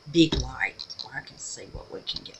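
low whisper to herself; whispered aside. Real, not acted. Crafter talking to herself under her breath, whispering. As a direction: drop to a whisper as you work. Muttering aside while working.

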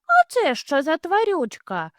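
put-on high, wondering child bunny; curious bunny. Little hare asks about the creature, high and curious. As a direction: ask like an amazed little one.

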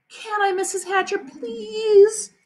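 high, drawn-out pleading; begging girl. A narrator's reading. Sheila begs to watch Fudge, high pleading. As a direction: beg sweetly, stretching the please. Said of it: "begged some more".

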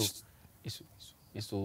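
very soft, voiceless whisper; whispered aside. Real, not acted. Co-host dropping a remark about a rumour, whispered. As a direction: slip it in under your breath. Murmured aside under another's talk.

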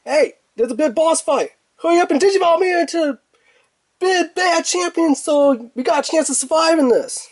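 high, fast, urgent cartoon plea; high squeaky creature pleading in a hurry; cartoon sidekick voice. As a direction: beg your partner to evolve you, quick.

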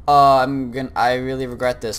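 normal talking voice

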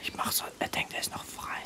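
secretive hushed whisper. Acted. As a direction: whisper it conspiratorially. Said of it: "So flüstern".

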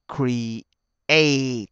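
"Create" broken into a long "kree" and "ate"; syllables split apart. Shown as a demonstration. Teacher showing students two separate vowels, drawn-out create. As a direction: stretch "Create" as two separate sounds, ee then ay. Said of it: "Hai âm nó rời nhau".

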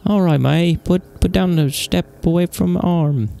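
high-pitched, slow British officer voice; British accent pitched well up. Acted. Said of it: "I did, like, a British accent, I think".

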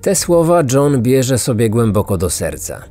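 plain reading voice

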